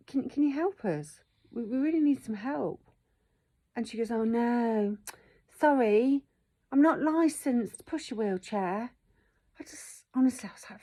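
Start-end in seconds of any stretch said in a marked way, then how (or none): 0.1-2.8 high, urgent appeal for help; pleading. Retold or imitated. Acting out her plea for help. 4.1-8.9 high-pitched mock apology, drawn out at the end; unhelpful jobsworth. Mimicking the unhelpful woman at the door.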